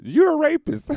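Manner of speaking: very high, loud heckler yell; heckler shout. Retold or imitated. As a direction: shout it from the crowd. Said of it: "the dude in the crowd said".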